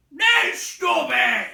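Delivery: loud, high, slow shout; troll shout. A narrator's reading. Tom and Bert shouting together. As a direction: yell the order. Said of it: "said Tom and Bert together".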